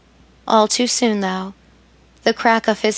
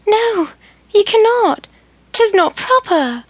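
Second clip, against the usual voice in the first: higher, louder panicked protest; 12 semitones higher, 4 dB louder than usual. A narrator's reading. Emily protesting to Charles in high alarm. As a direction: cry out no in alarm. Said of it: "she protested".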